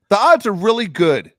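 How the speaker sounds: loud, high, indignant comeback; exasperated retort. Retold or imitated. Ranting at a silly Facebook post, exasperated retort. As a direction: snap back in outraged disbelief.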